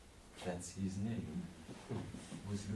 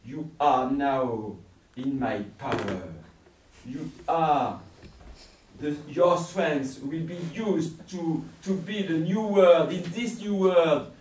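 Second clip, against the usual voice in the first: ranting villain; 6 semitones higher, 15 dB louder than usual. Acted. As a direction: build to a roaring threat. Villain seizes him, rants.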